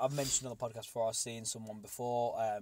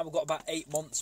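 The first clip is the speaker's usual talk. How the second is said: normal talking voice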